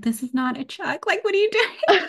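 mock outrage at the wrong blanket; half-laughing exasperation in a high voice; exasperated disbelief. Retold panicked birth quarrel.

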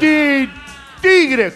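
high, loud stuck "ti" finally breaking into "tigre"; imitated stutter. Stuttering guide warning of a tiger, imitated stammer bursting into "tigre". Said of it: "que era tartamudo".

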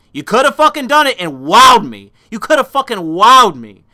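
loud, high frustrated yell at the film; frustrated outburst. Retold or imitated. Ranting at the movie in his seat, a loud frustrated yell. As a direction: shout your letdown at the screen. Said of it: "I'm like".

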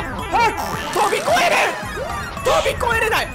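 high, loud exasperated shout; frustrated cry. Real, not acted. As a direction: yell at the missed jump. Failing a jump.

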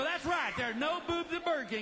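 high, animated dad reply; emphatic agreement. Retold or imitated. Father's emphatic reply retold.

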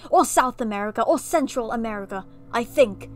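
hammy British storyteller with theatrical swells; overdone bad British accent; mock British accent. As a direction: read and comment as a pompous fake Brit. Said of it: "I'm just gonna do it anyway".

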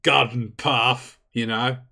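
brief the troops like Mick Dundee; Australian briefing. Acted. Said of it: "Why did you become an Australian?".

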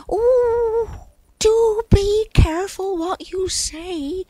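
very high, anxious-to-warn cloud voice; high-pitched, emphatic warning. A narrator's reading. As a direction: caution them in a high, squeaky register. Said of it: "warned Cloud".

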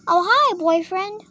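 high, lilting greeting; girlfriend figure. Pretend. Toy greeting her boyfriend, high lilting voice.

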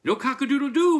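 high, very loud yell; outraged cry. A narrator's reading. Farmer shaking the silent rooster, high loud cry. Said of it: "Farmer McPhee cried".

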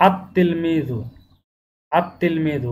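আত তিলমিজু with লাম dropped and the ত doubled; লাম বাদ দিয়ে দ্বিত্ব ত সহ আত তিলমিজু; silent lam. Shown as a demonstration. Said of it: "লামটা উচ্চারণ হচ্ছে না".

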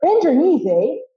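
Canadian lilt, slowed and bright; cheerful Canadian. Retold or imitated. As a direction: sing it up like a happy Canadian coach. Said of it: "with a cheerful Canadian rising inflection".

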